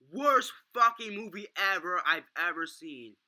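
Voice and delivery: loud, slow, drawn-out yell; outraged full-volume yell. Retold or imitated. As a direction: bellow it across the theater. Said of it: "my stepbrother just yells".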